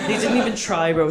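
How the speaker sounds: ordinary talk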